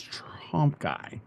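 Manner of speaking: hushed whisper on Trump guy. Shown as a demonstration. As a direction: breathe it out as a secret. Repeats phrase as a whisper.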